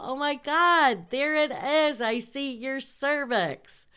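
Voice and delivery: high, loud thrilled cry; excited shout. Retold or imitated. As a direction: shriek it out in triumph. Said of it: "So I go".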